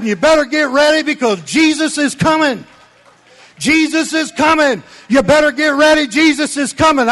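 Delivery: loud, high urgent call to get ready; impassioned shout. Retold or imitated. Preacher warning the congregation, Jesus is coming, loud and urgent. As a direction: ring it out to the crowd.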